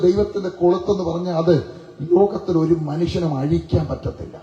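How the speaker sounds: normal talking voice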